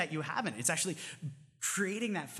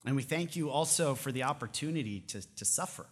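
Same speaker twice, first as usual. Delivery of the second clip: prayer; 4 semitones lower than usual. Acted. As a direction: close the sermon in hushed prayer. Closing prayer.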